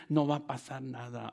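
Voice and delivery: low, softened voice of doubt; subdued, low tempter's aside; enemy's discouraging voice. Acted. Voicing the enemy's discouraging thought.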